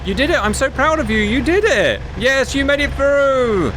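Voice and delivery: put-on high, gushing praise like to a toddler; mock proud praise. To the teammate who drove through, high gushing mock pride. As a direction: coo it out, over-the-top proud. Cheering the driver through checkpoint.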